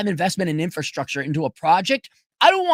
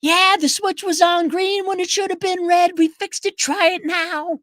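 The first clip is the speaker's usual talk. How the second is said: put-on high, cheery American agent accent; upbeat American accent explaining the fix; 11 semitones higher, 3 dB louder than usual. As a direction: play the helpful American rep. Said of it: "in an American accent".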